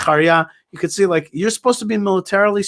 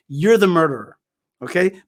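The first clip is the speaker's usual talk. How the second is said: normal talking voice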